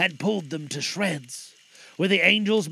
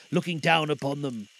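ordinary delivery

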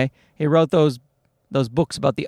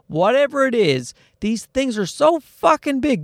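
high-pitched comic exasperation; exasperated rant; 7 semitones higher than usual. Real, not acted. Father ranting about oversized car seats, exasperated. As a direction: complain with the pitch pushed up.

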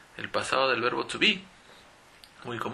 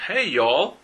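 hey y'all, louder with a rising Southern lilt; hey y'all, bright Southern hello; country greeting; 5 semitones higher, 7 dB louder than usual. Retold or imitated. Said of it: "Es como un country language".

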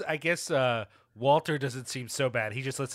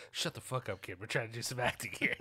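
quick, much softer grumbled imitation of an annoyed actor; muttered Dick Miller imitation; 2 semitones lower, 8 dB quieter, faster than usual. Podcast host imitating Dick Miller scolding the kid, quick soft mutter. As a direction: mutter it fast under your breath, mock irritated. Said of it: "I can't help but just him".

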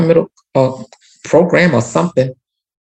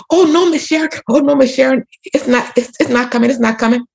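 hurried backpedaling; 9 semitones higher than usual. Retold or imitated. Man hastily backs down.

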